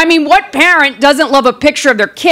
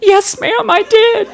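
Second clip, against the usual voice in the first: small high voice, imitating a sheepish clerk; mock-timid confession; meek photographer; 9 semitones higher, slower than usual. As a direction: admit it in a tiny high voice. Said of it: "And she said".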